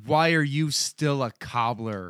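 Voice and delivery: ordinary delivery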